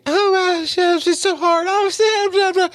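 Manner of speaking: very high, drawn-out mocking girly whine; exaggerated falsetto imitation of a pretty airhead; fake Disney girl. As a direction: squeak it out like a fake cartoon princess. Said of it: "she does this fake bullshit Disney girl".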